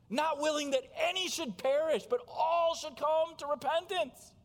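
impassioned preaching. Acted. Preacher urging the congregation to repent, loud and soaring. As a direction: proclaim it over the room. Verse repeated with preaching force.

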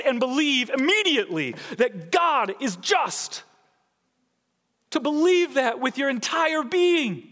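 high, loud pulpit preaching; fiery preaching. Acted. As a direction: drive it home to the congregation. Preaching at full force.

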